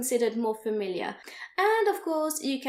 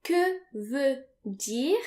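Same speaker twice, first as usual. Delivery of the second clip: slow model phrase; much slower than usual. Shown as a demonstration. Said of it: "you can say".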